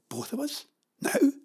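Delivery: high, startled questioning; taken aback. Acted. Jenny hears they must go now, taken aback. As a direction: ask it in high-pitched disbelief.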